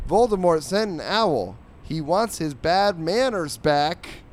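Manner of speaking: high, loud, stretched-out comeback; hammed-up cartoon dog. Dog in wizard hat retorts to cat, hammed-up. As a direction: proclaim the corny comeback, dragging the end. Dog's comeback read in character.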